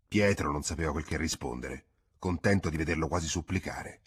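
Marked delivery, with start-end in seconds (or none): none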